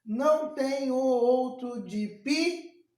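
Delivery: "Não tem o outro de pi-" slow and measured, stopping on PI; drawn-out verse scansion, cut off on PI; scanning to last stress. Shown as a demonstration. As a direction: count out each syllable up to PI. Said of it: "Eu conto até a última sílaba tônica".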